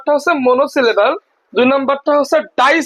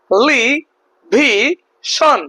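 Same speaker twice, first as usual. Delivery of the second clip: Television in four separate beats, te-li-vi-shon; television very slowly, a gap after each syllable; much slower than usual. Shown as a demonstration. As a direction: break television into four slow syllables with pauses. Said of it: "চারটা অংশে আমরা ভাগ করতে পারলাম".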